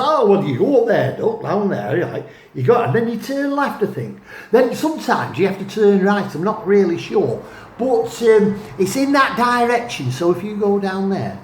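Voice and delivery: a not very good Potteries local from Stoke-on-Trent, unsure of the way; Stoke-on-Trent accent. Retold or imitated. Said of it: "that was my Stoke-on-Trent accent, not very good".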